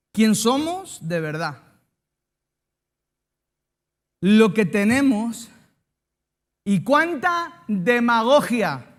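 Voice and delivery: slow, loud, weighty delivery of a maxim; preacher delivering his closing phrase, slow and loud; solemn proclamation. Acted. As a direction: declaim the phrase slowly like a closing sermon line. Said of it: "Ahí va la frase".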